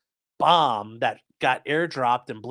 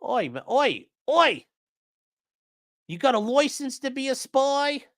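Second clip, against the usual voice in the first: high, hammed-up Australian "oi" call; mock Australian accent; 12 semitones higher than usual. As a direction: shout oi like a cartoon Aussie, much higher. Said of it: "You could be like".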